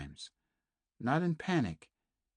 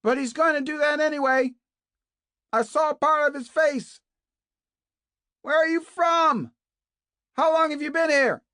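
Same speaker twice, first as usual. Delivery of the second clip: much higher and louder, urgent questions; shouting; 10 dB louder than usual. A narrator's reading. Kate keeps calling to Naomi, urgent shouting. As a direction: keep yelling through the wall.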